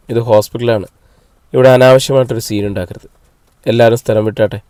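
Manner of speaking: ordinary delivery, nothing marked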